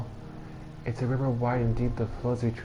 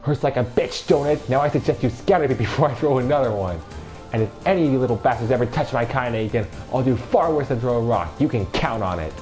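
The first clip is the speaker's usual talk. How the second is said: put-on louder, higher enraged old woman; thick with rage; 5 semitones higher, 7 dB louder, faster than usual. Old woman threatens the bullies, loud and enraged. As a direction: threaten the kids with fury. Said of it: "in a voice thick with rage".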